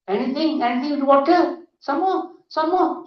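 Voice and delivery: very high-pitched, sing-song prompting; coaxing hypnotist. Retold or imitated. Hypnotist pressing the regressed woman about water, high coaxing.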